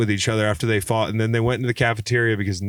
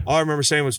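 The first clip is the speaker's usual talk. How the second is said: ordinary talk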